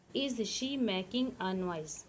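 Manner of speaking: very slow, word by word for students to write; slow, careful dictation pace. Shown as a demonstration. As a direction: spell it out slowly for the class to copy. Teacher dictating English question.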